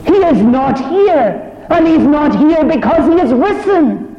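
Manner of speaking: high, loud, ringing proclamation; full-force preaching. Acted. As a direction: lift the whole room with the joy of it. Angels' joyful rebuke, preached high.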